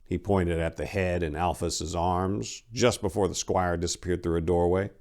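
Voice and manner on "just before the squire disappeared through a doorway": ordinary delivery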